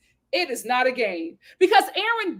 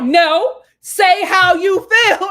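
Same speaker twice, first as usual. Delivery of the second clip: loud insistence; 6 semitones higher, 9 dB louder, much slower than usual. Retold or imitated. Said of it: "she was like".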